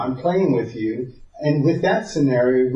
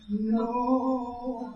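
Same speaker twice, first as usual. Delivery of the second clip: long drawn-out, high, softened "no"; mock slow-motion no; 9 semitones higher, 8 dB quieter than usual. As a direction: stretch out a pretend cry to block someone. Acts out stopping his wife.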